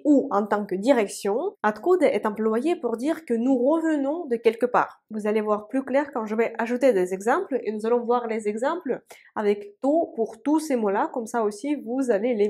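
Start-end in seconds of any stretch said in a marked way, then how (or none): none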